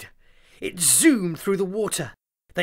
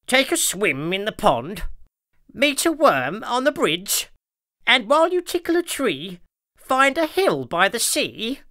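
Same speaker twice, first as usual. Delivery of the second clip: worker bee; 7 semitones higher than usual. A narrator's reading. Worker bee translating the dance.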